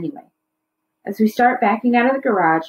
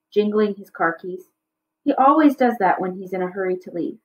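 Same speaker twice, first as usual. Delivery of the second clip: ordinary delivery, nothing marked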